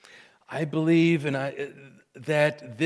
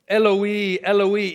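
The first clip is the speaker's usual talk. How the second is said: high, loud calling out; loud cry; 6 semitones higher, 6 dB louder than usual. Retold or imitated. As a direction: cry it out to heaven, raised voice. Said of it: "called out in a loud voice".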